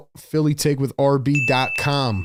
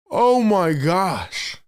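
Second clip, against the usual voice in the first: high start falling away, very slow; drawn-out astonished exclamation; stunned disbelief; 4 semitones higher, much slower than usual. Real, not acted. Streamer reeling from a near dunk, stunned disbelief.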